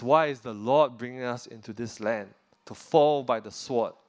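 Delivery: ordinary talk, nothing marked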